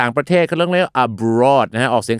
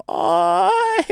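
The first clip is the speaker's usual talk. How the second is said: comic wail of protest; chair crying out. Pretend. Teacher plays a living chair sat on by three people, crying out. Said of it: "ถ้าเก้าอี้มีชีวิตเนี่ยนะครับ มันคงจะร้อง".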